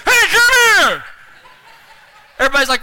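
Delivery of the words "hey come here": high, loud, drawn-out garbled order; numb-tongued shout. Retold or imitated. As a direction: yell through numb lips, comically high. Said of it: "tell an inmate what to do whenever you're talking like this".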